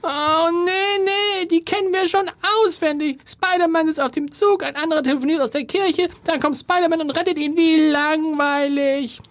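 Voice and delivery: put-on very high, whiny young daughter; little girl voice. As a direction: squeak it out like a bored girl. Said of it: "Ich bin die Tochter".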